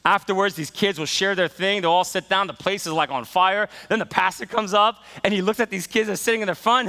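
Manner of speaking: normal talking voice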